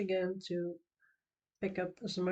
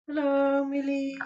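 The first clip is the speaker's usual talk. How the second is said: high, loud cheerful hello; excited greeting; 7 semitones higher, 8 dB louder than usual. Real, not acted. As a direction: call out a bright welcome. Greeting a new chat viewer.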